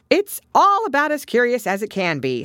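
high, loud creature verdict; Gryphon. Acted.

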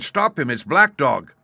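high, loud alarm; crying out. A narrator's reading. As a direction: shout to stop the runaway. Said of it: "I cried".

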